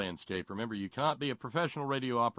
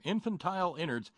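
chipper 1950s ad; 6 semitones higher than usual. Acted. Mock ad pitch.

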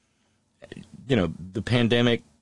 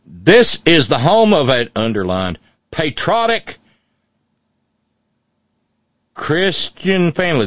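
loud, high hammed-up Southern dialect; mock dialect reading; 8 semitones higher, 9 dB louder than usual. Host reads the sign aloud in a loud mock Southern dialect. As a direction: bellow it like a proud Southern patriot. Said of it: "so I'm gonna read it in dialect".

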